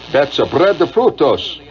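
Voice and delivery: slow, "bread de frutos" in a hammy mock Spanish lilt; mock island pronunciation. Shown as a demonstration. Host playfully mangling breadfruit in island Spanish, slow mock accent. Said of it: "the way it's pronounced on the islands".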